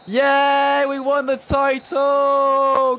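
high, loud, slow title celebration yell; triumphant shout. Real, not acted. Gamer celebrating winning the league title. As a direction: shout it to the rooftops. Final whistle, title won.

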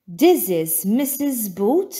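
plain reading voice

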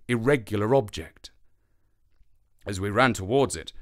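usual speaking voice

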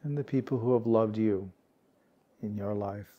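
calm, unhurried meditation guide, words spaced by long pauses; guided meditation. Real, not acted. Meditation teacher guiding warm-hearted peers to relax, calm and unhurried. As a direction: gently lead a sitting group into stillness, leaving space.

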